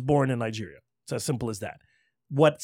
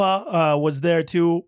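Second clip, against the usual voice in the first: ordinary delivery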